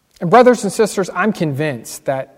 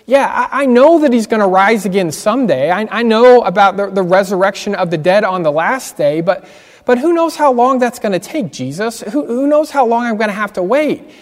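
higher, louder, pleading with frustration; frustrated plea; 5 semitones higher, 5 dB louder than usual. Retold or imitated. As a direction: Martha pours out her hurt to Jesus, frustrated and urgent. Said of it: "with a large degree of frustration in her voice".

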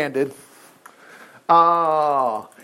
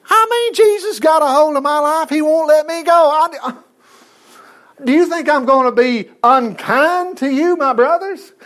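Joseph pleading; 7 semitones higher than usual. Retold or imitated. Joseph voiced pleading, crying.

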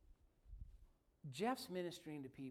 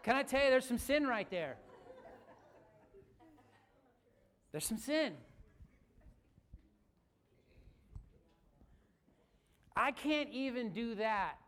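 much louder and higher, driving to the climax; full-force preaching; 7 semitones higher, 11 dB louder than usual. Acted. Pastor confesses his failings to the congregation, preaching full force. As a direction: hammer it home from the pulpit.

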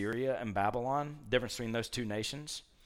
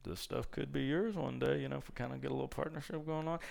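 sly pitch; 6 dB quieter, faster than usual. Retold or imitated. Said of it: "Shows him the treasury".

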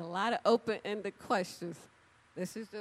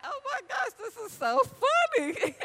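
laughing amazement; 14 semitones higher, 5 dB louder than usual. Retold or imitated. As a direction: laugh it out in delight. Laughing to herself.

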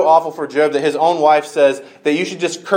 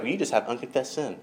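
quick, much softer, breezy pastor imitation; pastor's pat answer; 2 semitones lower, 13 dB quieter, faster than usual. Retelling her pastor's stock line, a quick soft breezy imitation. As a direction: toss it off lightly like a pastor who always says it. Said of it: "she talked like that".

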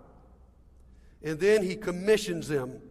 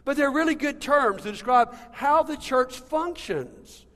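ordinary talk, nothing marked

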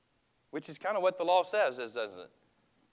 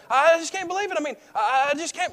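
much higher, louder near-shriek of disbelief; peak exasperation; 12 semitones higher, 8 dB louder, faster than usual. Retold or imitated. Upset person walking around the crowd, shrill disbelief. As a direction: lose it, stammering and squeaking. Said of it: "keep saying the same thing".

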